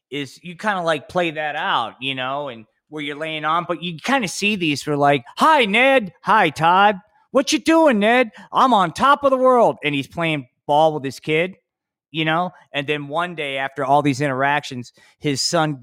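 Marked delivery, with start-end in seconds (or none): 5.4-9.8 high, loud, over-cheery ad characters; cheesy commercial voices. Pretend. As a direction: ham up a wholesome TV spot, bright and loud. Mock family commercial, cheery voices.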